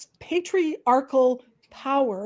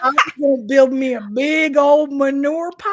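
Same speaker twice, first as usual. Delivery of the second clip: mock Southern farm-girl voice, a little louder; hammed-up Southern accent; 3 semitones higher, 6 dB louder than usual. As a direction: ham up the folksy twang. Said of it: "you say all the Southern things".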